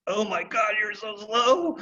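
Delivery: high, impatient groan of frustration; fed up with the dawdling horse; exasperated. Retold or imitated. Rider fed up with her slow horse, high and exasperated.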